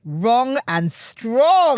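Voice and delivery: high, loud, very drawn-out slogan; slogan proclamation. Pretend. Host turning guest's phrase into a T-shirt motto, proclaiming. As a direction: proclaim it big, stretching each word.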